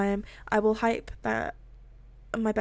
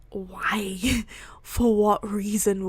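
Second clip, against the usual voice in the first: whispered disbelief; much slower than usual. Retold or imitated. Self-doubting creator asking fans why, baffled whisper.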